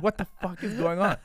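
high, loud retold disbelief; alarmed outburst. Retells his alarm at whispering nurses.

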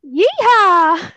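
loud Texan cowboy holler; yeehaw. Acted. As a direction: holler it like a Southeast Texas cowboy. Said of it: "that was Southeast Texas right there".

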